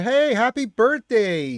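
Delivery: excited birthday greeting. Real, not acted. Streamer greeting a chatter on his birthday with excitement. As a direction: call it out big and slow.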